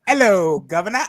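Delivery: second go at a hammy English accent; loud hello in an overdone English accent. Shown as a demonstration. Retrying the English accent after friends called it terrible, hammed-up English accent. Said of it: "hear my best English accent".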